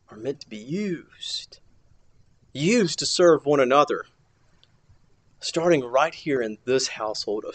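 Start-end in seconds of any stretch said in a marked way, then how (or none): 0.1-1.6 soft, slow whispered point; dramatic whisper. Acted. Preacher pressing the congregation to use their gifts, whispered. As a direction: drop to a slow whisper to make it land.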